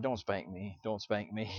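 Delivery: low, very soft mock plea; imitated pleading child. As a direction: lightly mimic a child begging under his breath. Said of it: "the whole time he's like".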